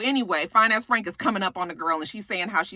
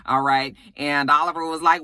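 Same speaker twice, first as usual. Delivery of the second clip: ordinary delivery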